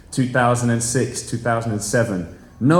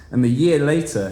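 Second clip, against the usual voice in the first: normal talking voice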